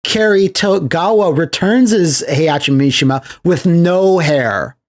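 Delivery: ordinary talk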